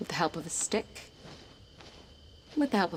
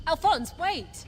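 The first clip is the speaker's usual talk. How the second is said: higher, urgent call after him; 10 semitones higher, much slower than usual. Acted. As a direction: call him back before he's gone. Boy runs off, she calls.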